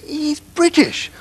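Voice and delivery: drawn-out insistence that he's British; a mock-stubborn correction; insistent retort. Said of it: "He said".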